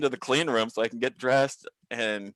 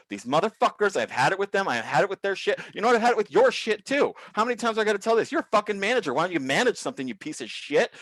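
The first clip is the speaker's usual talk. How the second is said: losing it; 8 semitones higher than usual. Retold or imitated. Worker losing control, ranting at his manager in a high furious voice. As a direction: blow up, every line pitched high and hot. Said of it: "really lost control in front of people".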